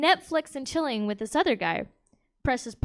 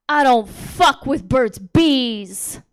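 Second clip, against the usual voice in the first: angry, aggressive; 9 dB louder, much slower than usual. Retold or imitated. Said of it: "looks at me so angry and aggressive".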